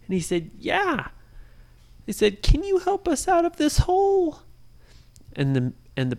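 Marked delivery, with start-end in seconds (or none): 0.6-1.1 a high little yes; tiny rabbit agreeing; high bunny voice. Acted. Bunny answers the children, high and small. 2.4-4.4 put-on high, hopeful children asking; little voices begging for help; high pleading kids. Kids in the hole ask the bunny for help, high and pleading.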